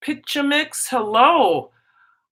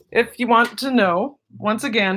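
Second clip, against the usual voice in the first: normal talking voice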